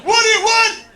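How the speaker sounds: high, loud stunned disbelief; shocked brother. Retold or imitated. Comedian playing his brother, loud and stunned. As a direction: brother caught off guard, squawking.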